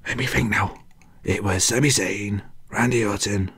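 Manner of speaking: under the breath, trying to recall; whispered thinking. Real, not acted. Host whispering to himself while recalling the show. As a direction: whisper to yourself while remembering.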